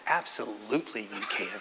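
soft, sneaky low reply with a laugh; sly mischief. Retold or imitated. Dad letting his son crash the photo, sly and soft. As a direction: conspire quietly with the kid. Sending kids into woman's photo.

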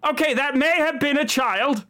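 high coachman aside. Acted. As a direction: toss it off from the driver's seat. Coachman voice again.

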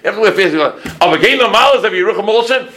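imitating a Yiddish-speaking elder; Yiddish-speaking rosh yeshiva. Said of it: "That's what he tells me".

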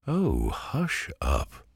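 high, slow hushing cry. Acted. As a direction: sharply hush them up. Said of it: "cried Pumpkin".

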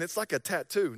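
usual speaking voice

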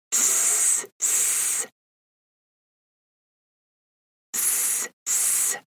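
soft hissing S; snake hiss. Shown as a demonstration. Snake sound guessing game.

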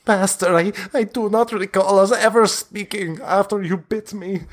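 high, pleading and wretched; a starving vampire begs his master for release; starved pleading vampire. Acted. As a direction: beg like a starving creature locked away. Spawn answers master.

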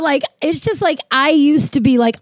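ordinary talk, nothing marked

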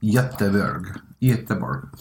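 Göteborg said the Swedish way, yuh-teh-borg; Göteborg with Swedish vowels; Swedish accent. Shown as a demonstration. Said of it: "Excellent Swedish accent there".

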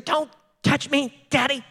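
put-on high, slow pouting little-kid voice; whiny toddler. Pastor imitating his son refusing his hug, high pouting whine. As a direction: drag it out like a sulking toddler. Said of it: "You ever had a kid say that?".

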